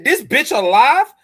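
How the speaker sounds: high, loud mock outburst of disbelief; imitating Matt's outrage. Host imitating cohost's outraged voice message, mock outburst. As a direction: ham up his furious disbelief. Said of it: "He said".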